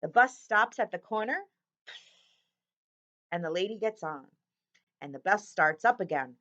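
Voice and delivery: lively storybook read-aloud; bright picture-book narration; reading to children. A narrator's reading. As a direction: read it like story time for kids.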